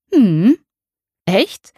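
mother surprised by the news. A narrator's reading. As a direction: perk up, half disbelieving. Mother hears news of God.

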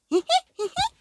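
squeaky tickling giggle; high fairy giggle. Acted.

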